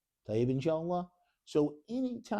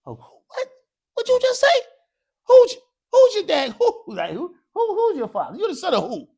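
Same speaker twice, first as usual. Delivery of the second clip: high, loud hammed-up shock; mock scandal, much higher and louder with laughs; mock outrage; 11 dB louder than usual. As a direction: play the scandalised questioner, squealing. Teacher mocks a confused father question.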